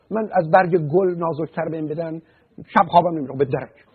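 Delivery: high, loud mocking imitation of touchiness; mock oversensitive person. Therapist mimics someone fragile as a flower petal, mocking oversensitivity. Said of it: "حساسم یعنی چی؟".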